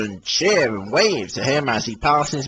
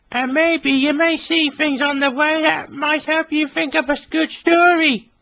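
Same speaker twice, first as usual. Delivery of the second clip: Billy voice. Acted. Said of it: "he whistled".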